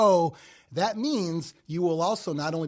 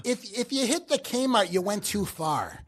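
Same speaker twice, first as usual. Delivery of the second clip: higher voice, slowing to the warning; Midwestern direction-giver; 4 semitones higher than usual. Acted. Midwesterner directing someone by landmarks, put-on higher voice. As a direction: imitate a local giving directions. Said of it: "you're giving someone directions".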